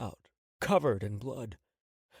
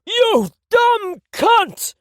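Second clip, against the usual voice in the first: very high, loud and drawn out scream; screaming curse; 12 dB louder, much slower than usual. Acted. Tony screams at Catalina after the second shot. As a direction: let the pain rip through it.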